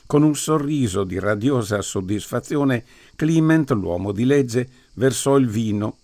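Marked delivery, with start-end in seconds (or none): none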